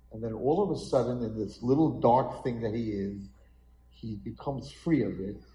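ordinary talk, nothing marked

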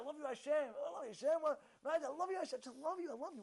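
ordinary delivery

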